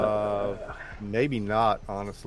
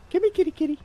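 high, loud sing-song call to cat; 4 dB louder, much faster than usual. Real, not acted. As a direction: coax the cat over sweetly. Trying to figure out where.

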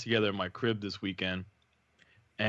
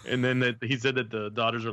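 usual speaking voice

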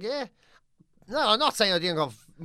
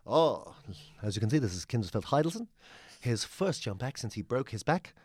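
lower, hushed sports commentator voice; mock solemn TV commentary, unhurried; lightly voiced ski jump commentator; imitating commentator; 7 semitones lower, 3 dB quieter than usual. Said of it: "the commentator starts going".

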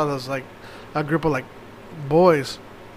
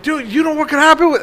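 stern yell; 10 semitones higher, 6 dB louder than usual. Retold or imitated. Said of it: "yelling at them".

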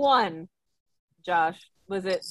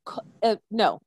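ordinary delivery

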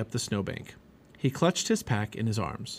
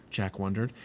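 ordinary delivery

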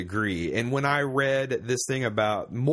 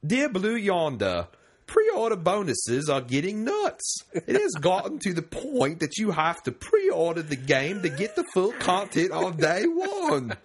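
slow, higher, hammed-up British accent; bad jolly British accent; 6 semitones higher, slower than usual. Host reading listener's letter, bad jolly British accent. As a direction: put on a badly faked jolly British voice, laughter creeping in. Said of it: "I'm gonna try a jolly British accent".

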